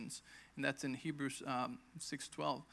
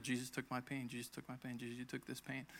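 soft murmured repetition, much softer; under my breath; 2 semitones lower, 9 dB quieter than usual. Retold or imitated. Patient in pain murmuring under her breath. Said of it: "started saying on my breath".

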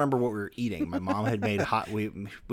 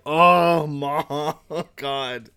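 loud mock dismay; teen despairing at mom; exasperated groan; 5 semitones higher, 8 dB louder, much slower than usual. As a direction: sigh it out at mom. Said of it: "And I was just like".

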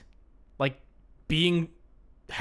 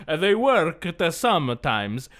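put-on slow, higher sing-song Italian accent; 4 semitones higher, slower than usual. Reading a review aloud to a friend in an Italian accent. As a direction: read the review like an old Italian man. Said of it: "you're just doing an Italian accent".

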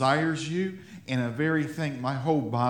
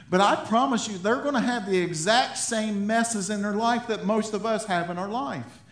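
higher, louder, fervent exhortation; preaching at full force; 6 semitones higher, 4 dB louder than usual. Acted. Pastor rallying his congregation, preaching with full force. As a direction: drive it home to the church.

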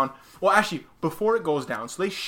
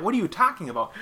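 ordinary talk

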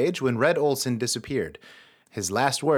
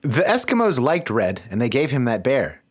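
ordinary talk, nothing marked

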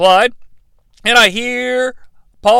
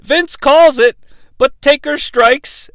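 usual speaking voice

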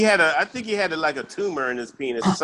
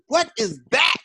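high, incredulous "What is that?"; stunned disbelief; 7 semitones higher than usual. Retold or imitated. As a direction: gasp it out in shock. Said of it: "I was like".

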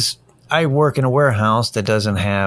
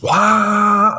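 fan roar; 12 semitones higher, 4 dB louder than usual. Retold or imitated. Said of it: "you turn it on low and it's just".

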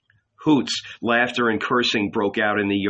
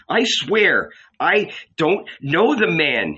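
higher, frightened denial; afraid; 7 semitones higher, 3 dB louder than usual. A narrator's reading. Peter denying Jesus to the servant, higher and afraid. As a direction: swear it in fear. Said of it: "he said".

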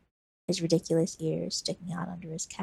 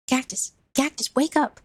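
higher, louder calls to wake her; urgent calling; 7 semitones higher, 4 dB louder than usual. Acted. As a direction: shake her awake with your voice. Boy wakes unconscious Cactus.

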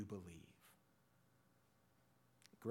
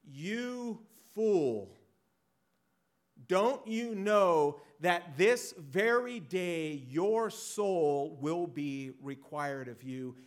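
God's stern rebuke; 6 semitones higher, 11 dB louder, slower than usual. Retold or imitated. God rebukes the rich fool.

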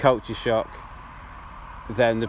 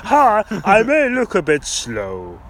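loud, high, slow dopey voice; dopey slow giant voice; 8 semitones higher, 6 dB louder, slower than usual. Pretend. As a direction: speak like a slow friendly giant. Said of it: "The big friendly Ian".

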